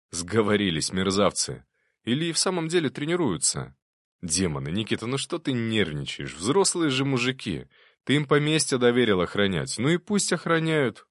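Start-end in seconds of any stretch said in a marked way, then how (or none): none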